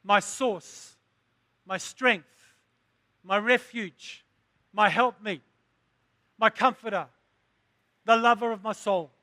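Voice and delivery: preaching litany. Acted. Litany of praise.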